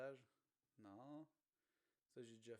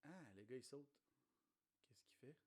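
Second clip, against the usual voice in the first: ordinary delivery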